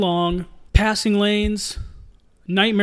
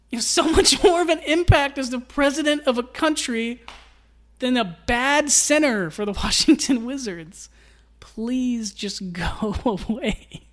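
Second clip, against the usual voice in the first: laughing plea; 5 semitones higher than usual. Real, not acted. Pleading with Gortat, laughing.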